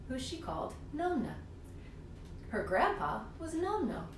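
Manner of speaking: ordinary delivery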